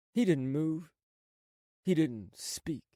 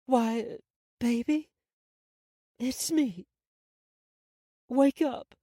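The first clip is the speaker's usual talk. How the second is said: high, desperate pleading; pleading mother; 9 semitones higher, 5 dB louder, slower than usual. Acted. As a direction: beg him to wake, voice climbing. Mother begging dead son wake.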